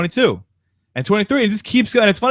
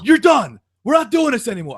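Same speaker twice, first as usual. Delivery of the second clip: manager shouting; 9 semitones higher than usual. Retold or imitated. Manager stands up and shouts at the whole waiting room. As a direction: bark orders at a noisy room. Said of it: "the manager stands up and goes".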